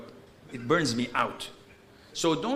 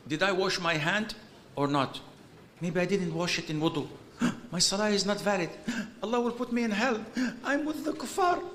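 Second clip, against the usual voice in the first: mock panic, gasping between hurried thoughts; anxious spiralling doubts; 4 semitones higher, faster than usual. Overthinker spiralling over washing before prayer, mock panic. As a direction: climb higher with each gasped worry.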